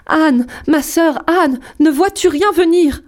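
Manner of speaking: appel très aigu et lent, plein d'angoisse; étire le nom comme un cri de détresse; appel désespéré. A narrator's reading. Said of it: "Puis elle criait". (l'épouse crie vers sa sœur dans la tour, appel désespéré)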